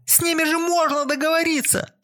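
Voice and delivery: very high shrieking plea; hysterical shouting. A narrator's reading. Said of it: "истерично кричала девушка".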